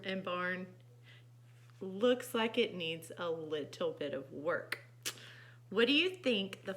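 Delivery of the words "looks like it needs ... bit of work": usual speaking voice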